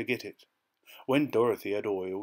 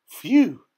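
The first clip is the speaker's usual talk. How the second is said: loud, high exhaled whew; whistled phew; 11 semitones higher, 13 dB louder than usual. A narrator's reading. Gatekeeper reacting to Dorothy's request, whistling phew. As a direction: let out a sharp high phew of disbelief. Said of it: "whistled the keeper".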